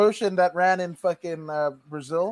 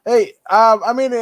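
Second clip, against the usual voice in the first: ordinary delivery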